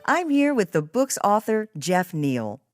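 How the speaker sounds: ordinary talk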